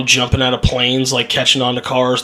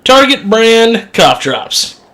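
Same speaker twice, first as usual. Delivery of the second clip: ad read voice; 7 semitones higher, 4 dB louder, much slower than usual. Acted. Mock ad read.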